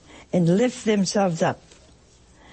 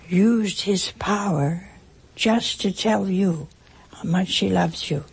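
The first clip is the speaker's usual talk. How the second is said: usual speaking voice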